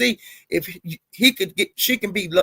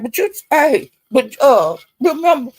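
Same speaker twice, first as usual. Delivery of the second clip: retold heated callout; 6 semitones higher than usual. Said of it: "And I was saying".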